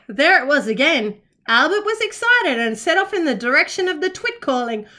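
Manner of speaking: ordinary delivery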